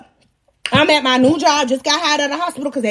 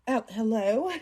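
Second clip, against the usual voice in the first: hushed phone answer; 4 semitones lower, 10 dB quieter than usual. Retold or imitated. Answers a phone call at work.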